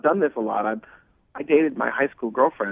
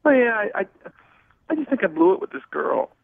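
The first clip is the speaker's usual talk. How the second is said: crying on the phone; 7 semitones higher than usual. Real, not acted. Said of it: "You sound like you're about to cry right now".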